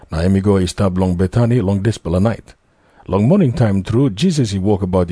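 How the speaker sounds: ordinary delivery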